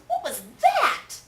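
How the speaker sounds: very high, very loud and slow; startled. Acted. Kitten startled by the shape in the mirror. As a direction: squeak it in alarm.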